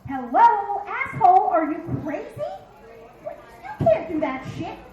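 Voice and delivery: loud, high mock-incredulous scolding; alarmed brain voice. Brain scolding owner mid-cartwheel, loud incredulous rebuke. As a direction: start loud and high, like a sudden reality check. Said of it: "your brain suddenly goes".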